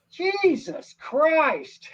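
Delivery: drawn-out, high, loud exclamation; loud outburst. Retold or imitated. Old man in theater shouting at the screen, loud outburst. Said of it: "Really loud".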